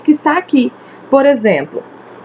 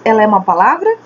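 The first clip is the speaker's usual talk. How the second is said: normal talking voice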